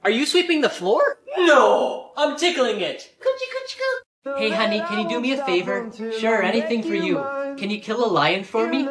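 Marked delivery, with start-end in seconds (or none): 3.2-4.0 very high, soft coochie-coo; baby-talk tickle. Acted. Tickling the floor, cooing very high and soft.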